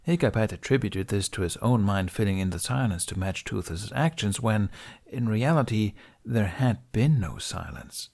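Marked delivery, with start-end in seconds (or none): none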